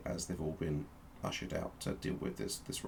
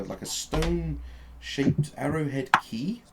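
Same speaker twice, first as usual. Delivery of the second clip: usual speaking voice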